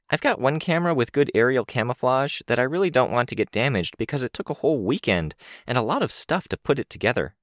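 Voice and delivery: put-on quick, high eager girl; young girl voice. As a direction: rattle it off like an excited kid. Kenzie's voice held.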